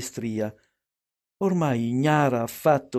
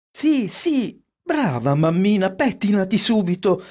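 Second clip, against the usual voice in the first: put-on high, eager childlike cheering; approving children; 6 semitones higher, 3 dB louder than usual. A narrator's reading. Children urging their mother on, eager approval. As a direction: egg mother on with high-pitched delight. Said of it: "approvarono i figlioli".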